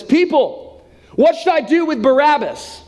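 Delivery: high, ringing public question; judge addressing the crowd. Acted. Judge asks the people about Barabbas, high projected call. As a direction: proclaim it to a crowd. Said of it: "the judge steps up to the people and says".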